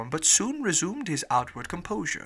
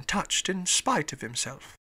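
ordinary delivery

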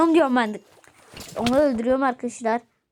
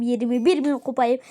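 ordinary talk, nothing marked

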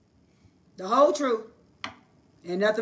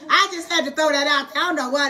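laughing; 7 semitones higher, 5 dB louder than usual. Real, not acted. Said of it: "laughing after the joke".

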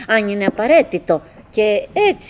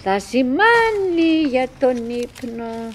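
a higher, slower self-soothing voice; talking to herself; 5 semitones higher, slower than usual. Acted. Winnie comforting herself about the bell for sleep. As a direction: reassure yourself gently, slowly. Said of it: "λέω στον εαυτό μου".

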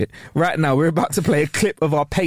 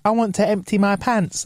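put-on higher, childlike announcement; little kid voice; 6 semitones higher than usual. Kid needing the toilet, imitated childlike voice. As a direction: imitate a cute kid, pitched up. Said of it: "when a kid goes like".

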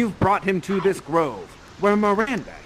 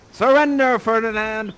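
high, loud call to surrender; commanding; 3 semitones higher, 6 dB louder than usual. Acted. Prospero to cornered Ferdinand, loud command. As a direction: order it out theatrically.

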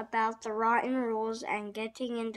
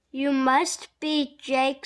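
big, slow, louder welcome; smiling greeting; 3 semitones higher, 8 dB louder, slower than usual. A narrator's reading. Miss Cherry meeting the new pupil, smiling loudly. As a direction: greet with an audible smile, loud and unhurried. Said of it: "smiled Miss Cherry".

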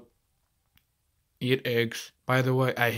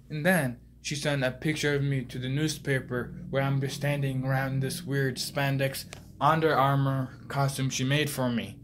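ordinary delivery

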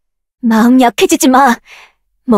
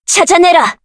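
higher command; 6 semitones higher than usual. Acted. Summoner commanding a creature, pitched up. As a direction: order it forcefully.

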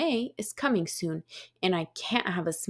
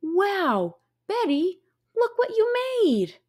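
higher, bright friend voice; May's voice; 9 semitones higher, 3 dB louder than usual. Acted. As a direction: sound like an amazed little friend. May's voice in dialogue.

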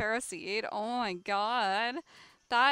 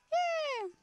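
excited. Real, not acted. Shiny in party.

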